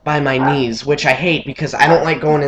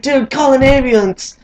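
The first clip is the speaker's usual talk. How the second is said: much higher "call an ambulance"; urgent plea; 11 semitones higher than usual. Retold or imitated. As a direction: beg for help in a high strained voice. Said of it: "And I was like".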